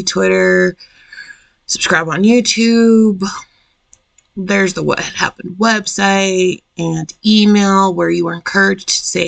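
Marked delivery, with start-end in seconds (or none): none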